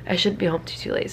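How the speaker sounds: usual speaking voice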